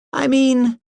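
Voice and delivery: high, coaxing mother's voice; mother coaxing her son into agreement, high and sweet. A narrator's reading. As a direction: coax him along, pitched well up. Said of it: "coaxed Missus Macbeth".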